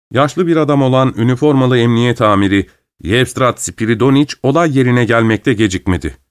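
usual speaking voice

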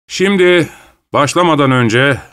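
high, projected announcement; addressing a crowd. A narrator's reading. Kroner addresses the crowd before starting, projecting. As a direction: raise the voice to get everyone's attention.

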